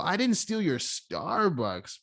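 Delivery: "Starbucks" leaned on and drawn out; slow line landing heavily on "Starbucks"; stress on STARBUCKS. Shown as a demonstration. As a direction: stretch "Starbucks", implying something else was taken. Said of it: "exact same words read with a different tonality".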